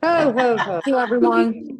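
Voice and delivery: jolly Santa laugh. Pretend. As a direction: boom it out like Santa. Christmas farewell, Santa laugh.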